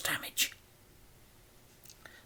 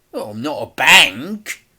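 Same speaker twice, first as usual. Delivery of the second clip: snapping; 4 semitones higher, 7 dB louder than usual. A narrator's reading. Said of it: "snapped Mister Big".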